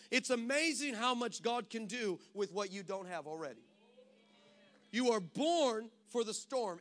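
ordinary delivery

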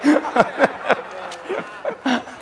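high laughing fit at his own joke; laughter. Real, not acted. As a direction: burst out in high, helpless laughter.

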